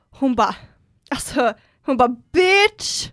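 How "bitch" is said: put-on cocky girl. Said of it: "hon tror att hon är så jävla cool".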